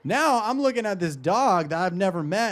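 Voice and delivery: ordinary talk, nothing marked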